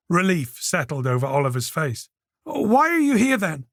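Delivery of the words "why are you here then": put-on a cornered young man begging; Oliver pleading. A narrator's reading. Oliver cowering at the door, begging Peter, higher.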